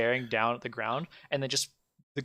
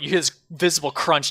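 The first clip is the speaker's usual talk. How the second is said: ordinary talk, nothing marked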